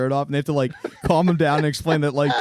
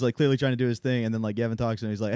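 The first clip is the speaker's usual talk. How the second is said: ordinary talk, nothing marked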